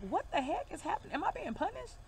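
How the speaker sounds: bewildered reaction. Retold or imitated. Tarot reader retelling her reaction to her own life, bewildered. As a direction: act out being baffled by your own life.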